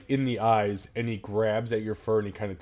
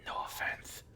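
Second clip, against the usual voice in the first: soft, secretive whisper; conspiratorial whisper; 12 dB quieter than usual. Acted. The kid whispering the secret deal to the prisoner.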